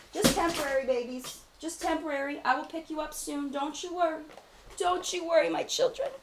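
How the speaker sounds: high, soft cooing to her makeup; baby talk to palettes. Pretend. A parent softly soothing her babies, set down on the floor. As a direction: coo to them like a parent settling babies.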